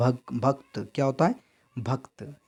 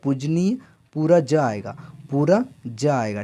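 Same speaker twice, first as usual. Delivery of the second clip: slow; 2 semitones higher, 5 dB louder, slower than usual. Shown as a demonstration. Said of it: "teacher stresses the full ja".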